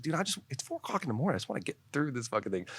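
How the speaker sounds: high, rushed protest; exasperated retold plea. Retelling plea to border officer.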